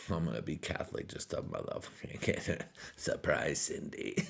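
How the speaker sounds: sneaky whisper. Retold or imitated. Sneaky convert mock aside.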